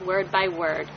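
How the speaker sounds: ordinary delivery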